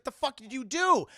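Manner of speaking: high, loud mock fury; outraged demand. Demona berating Puck over the botched spell, loud high outrage. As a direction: explode at a bungling underling.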